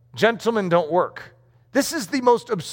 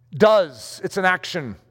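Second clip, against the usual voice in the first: ordinary talk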